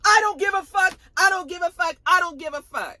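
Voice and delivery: high, defiant repeated outburst; mock yell that starts loud and eases off; imitated screaming. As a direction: imitate someone screaming back, refusing to listen. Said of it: "She's all screaming at Wendy".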